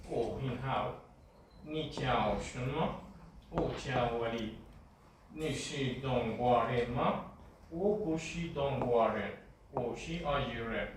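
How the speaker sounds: usual speaking voice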